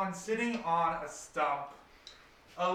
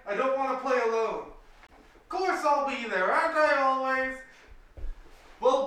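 loud, raised-pitch shouted conversation; yelling back and forth; 8 semitones higher, 7 dB louder than usual. Acted. Tang on the cliff and Greta in the boat, shouting. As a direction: project every line across the water. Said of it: "a voice yells up from the boat".

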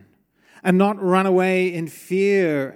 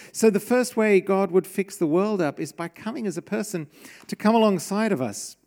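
ordinary talk, nothing marked

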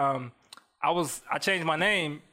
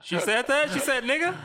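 shocked; 10 semitones higher than usual. Retold or imitated. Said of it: "And I was like".

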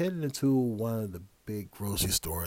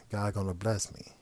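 ordinary talk

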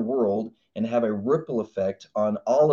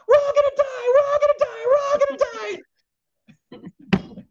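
far higher, louder, hammed-up panic; panicked fear voice; 5 dB louder, faster than usual. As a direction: shriek like the fear in your head. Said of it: "Anyone got a fear voice on their table?".

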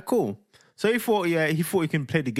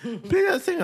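put-on high whiny child's begging; pleading kid. Son begging dad not to sing. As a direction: beg like a boy who did his homework. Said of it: "He's like".

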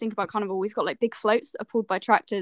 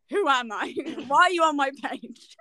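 confused boomer; slower than usual. Acted. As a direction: play a baffled boomer, words drawn out. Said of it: "boomers, basically. They don't know how".

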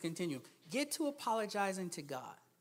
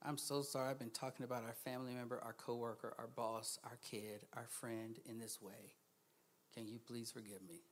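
soft apology; 10 semitones lower, 12 dB quieter than usual. Shown as a demonstration. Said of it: "You gotta say something to him like".

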